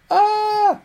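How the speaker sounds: sudden high shriek, very loud; mock alarmed yelp. Python yelping at a type mismatch, high shriek. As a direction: yelp like a startled program. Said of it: "It's like".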